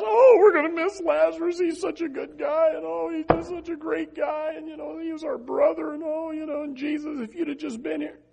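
high-pitched mock lament of the bereaved; put-on high voice of grieving friends; mock mourners. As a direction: imitate mourners going on and on in a high voice. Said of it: "He, everybody else".

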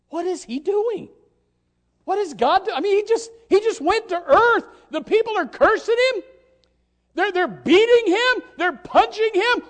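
high, loud, incredulous outcry; baffled angels. Retold or imitated. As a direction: build the angels' disbelief to a peak. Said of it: "I imagine those angels going".

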